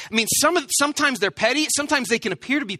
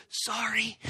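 barely audible whispered apology; a whispered sorry to the driver behind; 10 dB quieter than usual. Shown as a demonstration. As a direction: whisper it toward the rearview mirror. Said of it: "and you whisper".

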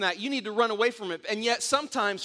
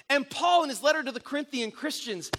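usual speaking voice

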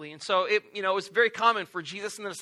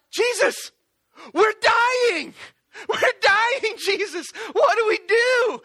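high, loud hammed-up panic; terrified disciples pleading with Jesus; mock panic; 13 semitones higher, 6 dB louder, slower than usual.

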